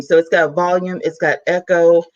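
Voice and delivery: ordinary talk, nothing marked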